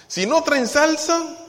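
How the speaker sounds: high, loud whiny complaint; mock complaint. Preacher mocking picky church members, loud high whine. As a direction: whine loudly about the tacos.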